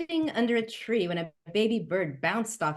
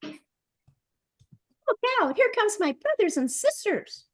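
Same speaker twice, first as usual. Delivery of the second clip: put-on very high, piping baby bird call; bird crying out; 13 semitones higher, much slower than usual. A narrator's reading. Baby bird warning Curie of falling siblings, very high. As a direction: shout a warning in a tiny bird voice. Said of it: "the bird cried".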